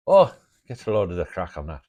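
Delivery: loud hammed-up Irish accent; mock Irish accent. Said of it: "maybe they don't say it that way".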